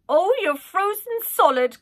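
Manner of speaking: put-on high, loud, fussing old nanny. Old nanny fussing over the cold prince, high and loud. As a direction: scold him kindly like a doting nanny.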